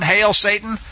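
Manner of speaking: usual speaking voice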